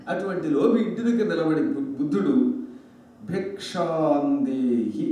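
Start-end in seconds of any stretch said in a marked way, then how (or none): 3.3-5.0 very slow, low, stretched-out call; long-held syllables, low and unhurried; Buddha's alms call. Acted. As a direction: intone it like a monk at the door. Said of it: "అన్నాడు".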